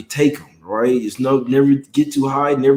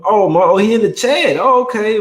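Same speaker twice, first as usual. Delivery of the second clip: higher, louder, delighted shout-out; light up on seeing him in chat; excited surprise; 8 semitones higher, 4 dB louder than usual. Real, not acted. Spots a viewer in chat.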